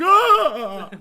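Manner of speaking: loud, high mock scream; terrified scream. Someone screams as you enter a room, terrified mock scream. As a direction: shriek as if someone just walked in. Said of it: "someone goes".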